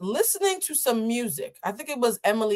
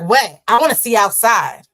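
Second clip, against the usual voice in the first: indignant seatmate; 10 dB louder than usual. Retold or imitated. Seatmate demanding the view, loud indignant retort. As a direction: snap back, offended. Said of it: "She's like".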